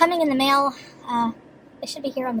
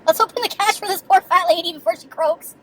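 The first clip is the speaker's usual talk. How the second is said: imagined cashier voice; 5 semitones higher, 6 dB louder than usual. Retold or imitated. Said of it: "I probably felt bad".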